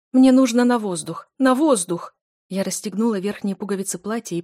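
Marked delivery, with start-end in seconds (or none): 0.1-2.1 loud, breathless plea for air; panicked cry. Acted. Heroine gasps to Bertrand that she needs air, panicked shout. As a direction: shout it as if suffocating.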